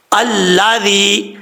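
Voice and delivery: الذی drawn out very slowly, ذال with no whistle; careful demonstration. Shown as a demonstration. Teacher shows students the correct ذال, slow careful demo. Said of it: "ذال میں سیٹی نہیں آئے گی".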